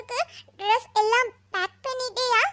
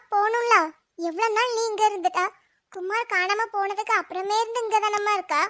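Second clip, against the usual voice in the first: normal talking voice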